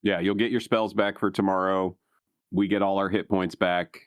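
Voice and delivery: usual speaking voice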